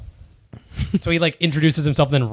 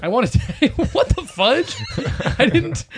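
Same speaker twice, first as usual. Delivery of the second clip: voice cracking; 9 semitones higher than usual. Real, not acted. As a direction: voice breaks high. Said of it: "my voice didn't crack".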